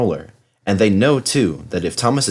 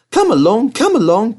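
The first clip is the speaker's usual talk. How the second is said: higher, louder puffing call; puffed; 12 semitones higher, 5 dB louder than usual. Acted. Thomas hurries his coaches, puffing higher. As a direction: puff it out like a busy engine. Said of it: "puffed Thomas".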